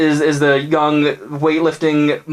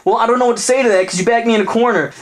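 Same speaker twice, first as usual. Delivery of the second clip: put-on cornered girlfriend; 9 semitones higher than usual. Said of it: "she's like".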